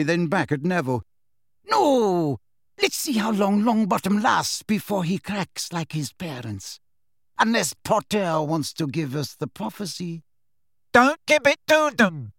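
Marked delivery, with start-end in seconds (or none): 1.6-10.2 higher, eager cruel glee; excited Bellatrix. A narrator's reading. Bellatrix thrilled at torturing Neville, higher and eager. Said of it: "alive with excitement". 10.9-12.3 much higher, louder desperate cry; roared. A narrator's reading. Neville roaring at Harry not to give in, much higher and louder. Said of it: "roared Neville".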